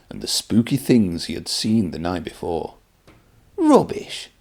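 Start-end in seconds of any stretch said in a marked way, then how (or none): 3.6-4.3 much higher, cheeky scoffing laugh. Acted. Thomas mocking Henry's ghost story, cheeky high scoff. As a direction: brush it off like a cheeky little engine. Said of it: "Thomas laughed cheekily".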